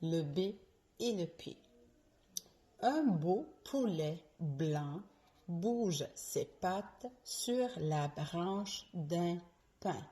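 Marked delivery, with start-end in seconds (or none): 2.8-10.0 tongue twister said slowly, every B and P crisp; "Un beau poulet blanc" with popped P and voiced B, slow; virelangue lente. Shown as a demonstration. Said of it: "Je commence avec la première phrase". (virelangue articulée lentement, B et P bien distincts)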